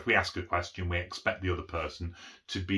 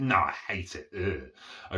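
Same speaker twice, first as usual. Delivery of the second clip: sarcastic; slower than usual. Shown as a demonstration. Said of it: "Obviously it's sarcasm ... because of the tone".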